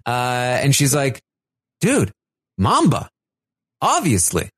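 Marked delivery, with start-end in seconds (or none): 1.8-4.5 higher-pitched, word-by-word "obviously" tone; mock incredulity. Paloma reminds her ally of Mamba, incredulous. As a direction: imitate someone stating the obvious, pausing between words. Acts out Paloma's impatient answer.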